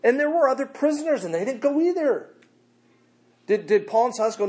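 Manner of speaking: high, loud fervent preaching; fired-up pulpit delivery; full-force preaching. Acted. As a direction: preach it to the rafters.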